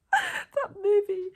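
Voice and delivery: a drawn-out emotional cry; wailing about the movie she loved; overwhelmed wail. Real, not acted. Overwhelmed by the Spider-Man film.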